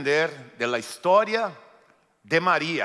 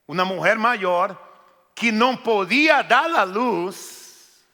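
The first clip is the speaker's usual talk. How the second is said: raised, higher storytelling preach; fervent preaching; 5 semitones higher, 7 dB louder than usual. Acted. Preacher to congregation, fervent preaching. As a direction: drive the story home to the church.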